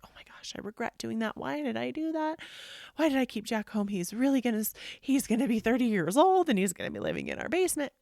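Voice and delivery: anxious self-reproach. Acted. Acting out anxious regretful self-talk.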